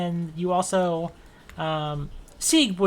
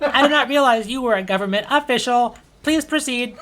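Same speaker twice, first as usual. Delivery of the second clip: robot voice; 6 semitones higher, 7 dB louder than usual. Acted.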